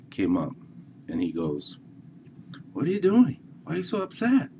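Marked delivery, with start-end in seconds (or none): 2.7-4.5 put-on high, gently questioning old man; an old man coaxing a frustrated kid, voiced high; old man asking. Old man asks the upset boy why, pitched high.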